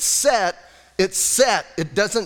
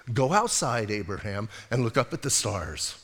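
much softer and lower, tender; gracious God; 8 semitones lower, 6 dB quieter than usual. Acted. God gently inviting sulking Abraham to look at the stars, soft and tender. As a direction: coax him gently outside like a kind father. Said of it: "Don't you love how gracious God is?".

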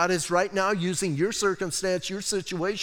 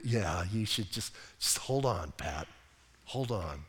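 much lower, softer God voice; low soft God telling Pat to wait; deep calm God voice; 10 semitones lower, 10 dB quieter than usual. Retold or imitated. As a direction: settle him down as God, low.

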